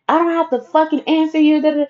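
high, defiant friend voice; mock going off. Friend refusing to answer, mock defiant. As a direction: raise the pitch and refuse.

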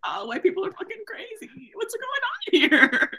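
mock squeaky high voice of disbelief, slowing at the end; incredulous onlooker. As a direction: play it as a baffled bystander.

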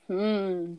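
usual speaking voice

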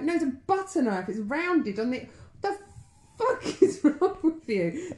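high, building disbelief ending in a laugh; mock outrage at a ridiculous habit; exasperated outrage. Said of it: "They're like".

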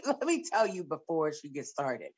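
ordinary talk, nothing marked

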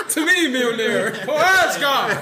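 lightly voiced Thor battle cry; a hint of a Norse warrior calling his hammer; viking. Thor-style battle cry impression.

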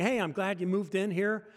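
usual speaking voice

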